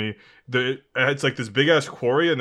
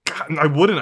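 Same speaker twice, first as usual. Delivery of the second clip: normal talking voice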